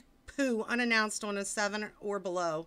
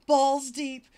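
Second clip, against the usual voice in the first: loud dramatic read; 5 semitones higher, 9 dB louder than usual. Acted. Dramatic reading climax.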